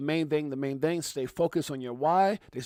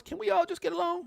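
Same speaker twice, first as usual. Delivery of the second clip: much higher pleading voice; hammed-up plea, pitched way up; Rodney King voice; 14 semitones higher than usual. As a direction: plead for peace in a high voice. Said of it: "In my Rodney King voice".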